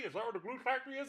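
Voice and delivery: high, quick anxious horse; hurried high horse voice; fast worried horse. Acted. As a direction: blurt it out nervously. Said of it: "his voice is really close to my normal voice".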